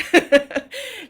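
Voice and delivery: short, high, loud laugh. Real, not acted. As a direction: burst out laughing briefly. Laughs after recalling her twenties.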